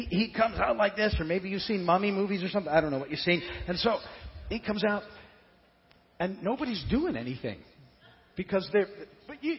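ordinary talk, nothing marked

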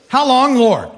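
hammed-up plea called heavenward; calling out. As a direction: call it out, high and strong. Acts Isaiah calling out to God.

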